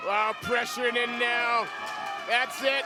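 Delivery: loud, high final-bell call; excited commentary. Real, not acted. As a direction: call the last seconds with energy.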